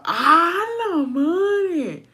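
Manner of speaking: high, stunned ah la madre; shocked exclamation. Real, not acted. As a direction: gasp it out in disbelief. Shocked at the mother-in-law.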